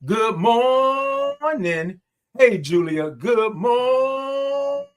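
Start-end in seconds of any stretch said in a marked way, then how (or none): 0.0-2.0 high, long-held morning call; drawn-out greeting. Real, not acted. Host greets viewers from Jacksonville, drawn out high. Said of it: "I love all those O's and R's". 3.1-4.9 high, singsong "mooornin'"; drawn-out greeting. Real, not acted. Host greets Julia on the stream, singing it out long. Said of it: "I love all those O's and R's".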